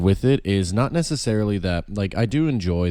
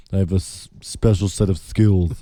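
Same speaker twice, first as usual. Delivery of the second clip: Qui-Gon Jinn. Retold or imitated. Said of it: "Qui-Gon Jinn was just like".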